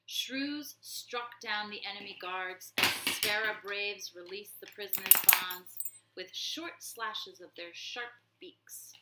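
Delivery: plain reading voice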